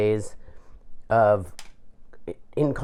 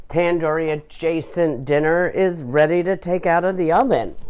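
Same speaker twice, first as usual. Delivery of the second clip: usual speaking voice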